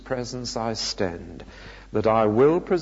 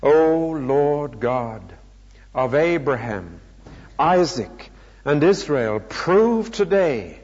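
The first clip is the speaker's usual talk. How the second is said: praying aloud; 3 semitones higher than usual. A narrator's reading. Elijah prays at the altar for proof, imploring. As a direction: implore God with rising fervour. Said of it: "walked up to the altar and prayed".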